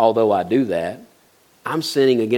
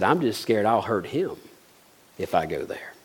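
quiet sincere reply; 3 semitones lower, 6 dB quieter than usual. Retold or imitated. Girl's quiet sincere answer.

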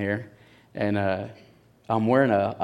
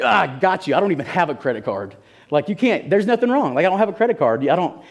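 high, loud insistent protest; frantic protest; 7 semitones higher, 3 dB louder than usual. Retold or imitated. Insisting to the officer he has no credit card, high and loud. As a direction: talk fast over him, sure he's wrong.

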